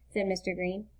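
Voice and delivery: plain reading voice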